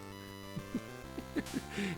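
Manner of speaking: breathy burst of laughter; helpless laugh. Real, not acted. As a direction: crack up at the failure. Fails jump, cracks up.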